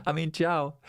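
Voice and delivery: normal talking voice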